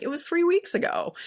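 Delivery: mock retort, pitched high; mock Harry retort. Harry's retort in retold scene.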